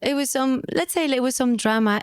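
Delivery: ordinary talk, nothing marked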